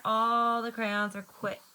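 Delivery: plain reading voice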